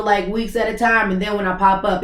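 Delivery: ordinary talk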